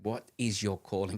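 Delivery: ordinary talk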